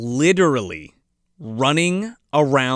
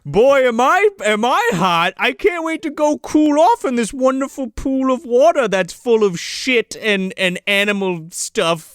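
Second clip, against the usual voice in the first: raised, hammed-up eager voice; mock hearty Santa; 6 semitones higher than usual. Santa heading for filthy water, mock eagerness. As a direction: gush with pretend delight, pitched up.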